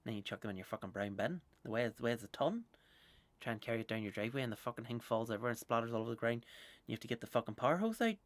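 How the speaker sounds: normal talking voice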